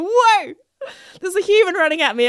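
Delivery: high, loud mock alarm; startled cow. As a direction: play a cow taken aback, comically. Voices startled cow seeing a human.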